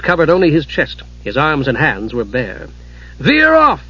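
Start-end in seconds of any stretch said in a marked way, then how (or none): 3.2-3.8 high, loud yell to the dragon; yelled order. Acted. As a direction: shout over the roaring fire. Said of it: "Steel yelled to Flare".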